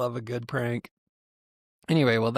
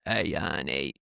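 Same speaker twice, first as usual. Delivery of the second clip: put-on low, husky old lady on the phone; old lady smoker voice; 8 semitones lower than usual. Prank caller putting on an old smoker's voice. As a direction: croak it like a chain-smoking grandma. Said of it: "old lady smoker's voice".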